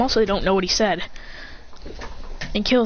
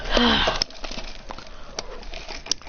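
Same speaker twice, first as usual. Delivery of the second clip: long weary sigh; 2 semitones higher, 6 dB quieter than usual. Acted. Tired after punching fish.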